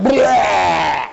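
loud imitated vomiting sound; mock puke noise. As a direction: blurt out the baby's puke. Baby throws up on him.

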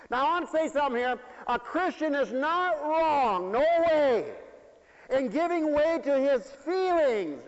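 high, loud, emphatic declaration; forceful preaching. Real, not acted. Preacher defending Christians who show feelings, forceful proclamation. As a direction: proclaim it at full force, stretching key words.

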